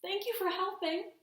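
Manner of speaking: put-on a small grateful kid; bright thanks from a child; child's voice. Child thanks the snow bear.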